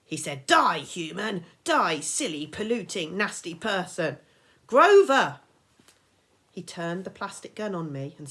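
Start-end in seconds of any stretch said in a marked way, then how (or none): 0.5-4.2 louder, gleeful fake fury; mock game battle cry. Grover lost in hunter game. 4.7-5.4 loud, high yell of his name. Acted. Percy yells at Grover.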